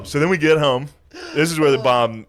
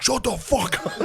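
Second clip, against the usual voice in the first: mock snap; 13 semitones higher than usual. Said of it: "finally he was just like".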